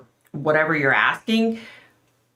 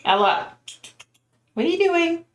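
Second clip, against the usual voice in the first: high squeaky voicing of the cat, higher throughout; 12 semitones higher than usual. Streamer voices her cat calling to Ella, high mock cat voice. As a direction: put the words in the cat's mouth, pitched way up. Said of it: "She's like".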